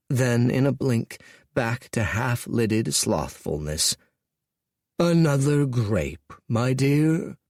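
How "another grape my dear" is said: slow, languid offer; lazy charm. Acted. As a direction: drawl it like a bored king.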